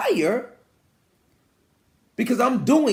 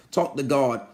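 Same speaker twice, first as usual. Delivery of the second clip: usual speaking voice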